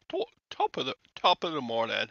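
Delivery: badly faked Irish, starting high and breaking off; failed Irish accent. Retrying the Irish greeting, loud botched accent. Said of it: "I can't talk Irish".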